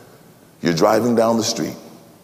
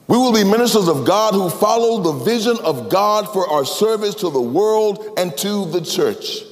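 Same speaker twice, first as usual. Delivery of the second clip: raised, ringing sermon voice; impassioned preaching; 7 semitones higher than usual. Acted. Pastor preaching to his ministers, with full fervor.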